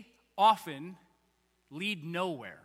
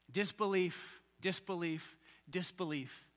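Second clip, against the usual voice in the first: each disbelief lower and softer, dying away; fading repetition; 4 semitones lower, 8 dB quieter, slower than usual. Acted. Preacher repeats disbelief, fading to a hush. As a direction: let disbelief sink quieter each time. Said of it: "repeated word fading".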